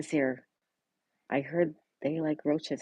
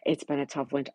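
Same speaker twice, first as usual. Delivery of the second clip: normal talking voice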